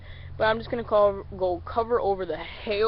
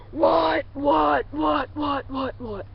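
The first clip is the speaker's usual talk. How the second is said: "what" shouted high and loud, then fading down; hail call; 7 semitones higher, 5 dB louder, much slower than usual. Shown as a demonstration. Showing a Hail Mary call to ducks far away. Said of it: "So once again".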